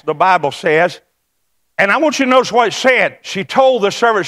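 ordinary talk

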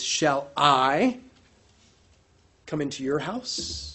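"Shall I" rising, then fading off; stress on shall I. Shown as a demonstration. Preacher stressing the question up front. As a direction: hang the question on "I". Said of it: "the emphasis up front is".

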